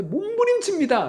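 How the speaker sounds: sudden high, loud pleading cry; mock pious prayer. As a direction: ham up a showy prayer. (과장된 경건한 기도 목소리)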